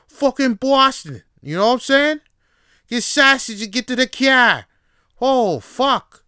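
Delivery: loud, high hammed-up Boston guy; loud mock Boston accent drifting into New York; bad Boston accent. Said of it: "I don't know how to do a proper Boston accent".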